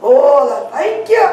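usual speaking voice

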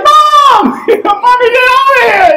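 put-on high, loud childish wail; crying for mom. Twelve-year-old boy shouting for his mom. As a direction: call for mommy like a scared kid. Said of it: "tough guy cried".

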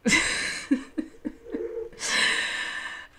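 big real burst of laughing; real loud laugh; loud laughter. Real, not acted. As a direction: crack up loudly. Laughing at Murray voice.

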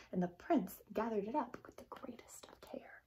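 hushed careful whisper; gentle storyteller whisper. A narrator's reading. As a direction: whisper it as if holding glass. Tender narration of the slipper.

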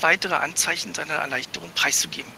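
ordinary delivery, nothing marked

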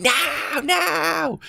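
very high desperate shriek; imitating the lady's panicked shriek; screaming no. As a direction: scream it as the car comes. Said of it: "ran in front of the car screaming".

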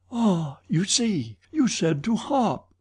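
high-pitched, anguished protest; distressed woman. A narrator's reading. Christine protests to Eric in alarm.

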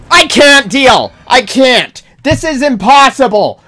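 ordinary talk

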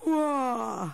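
high yawned wow, loud; yawning wow. A narrator's reading. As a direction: yawn the word out. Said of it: "gaapte hij". (hoog gapend wow)

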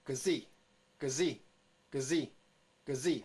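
G Z as gzi, slowly repeated with weight on zi; short g into a punched zi for G Z; sound drill. Shown as a demonstration. Teacher models the next letter sound, spaced sound drill. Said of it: "在下面来看".

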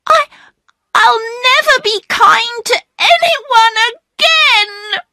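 put-on a child bursting into tears; crying. Phyllis, hurt, cries out tearfully. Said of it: "starting to cry".

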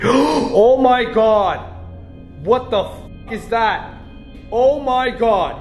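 real shriek of fright; terrified scream. Real, not acted. Stumbles on something frightening.

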